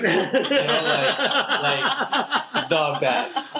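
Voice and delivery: laughing. Real, not acted. Laughing after the impression.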